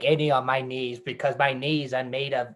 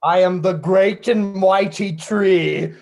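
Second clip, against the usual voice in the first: mighty tree; 4 semitones higher, 6 dB louder, slower than usual. Acted. Playing a tree.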